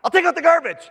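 high, resentful mock retort; grudging husband. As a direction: grumble it like a sulking husband. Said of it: "and I say".

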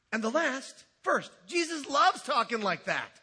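ordinary talk

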